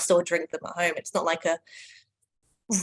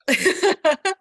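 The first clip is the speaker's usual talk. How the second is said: loud, high burst of laughter; real laugh, high and loud; 12 semitones higher, 8 dB louder than usual. Real, not acted. Reacts to the retirement joke.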